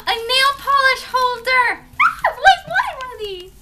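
loud, high thrilled squeal. Real, not acted. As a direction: squeal with delight at the gift. Thrilled by nail polish holder.

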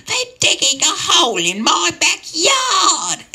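slow, very high-pitched wailing shriek; hammed-up, piercingly high complaint; screaming old lady. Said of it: "this old lady came out screaming".

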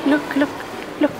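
usual speaking voice

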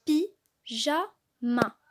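pi-ja-ma split into three slow, separate syllables. Shown as a demonstration. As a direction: blend the syllables slowly for the class. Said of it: "L'association de ces trois syllabes donne".

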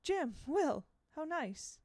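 put-on high, bright welcoming cry for the boys; beaming teacher. Miss Foley beaming at the boys, high and delighted. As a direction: greet the two boys with delight at the door. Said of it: "Miss Foley beamed out at them".

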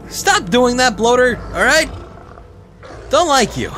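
high, loud telling-off; shouted scolding. Real, not acted. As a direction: shout crossly at the monster. Scolds attacking bloater.

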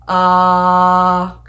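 AH held long and loud on one pitch; sustained level AH, no movement; flat monotone. Shown as a demonstration. Teacher demonstrating the first tone, very flat. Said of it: "it's very flat".

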